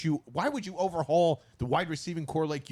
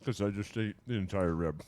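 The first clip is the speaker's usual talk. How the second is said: talking through a mouthful of ribs; words round a bite of food; mouth full; 3 semitones lower than usual. Real, not acted. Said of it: "My mouth is full".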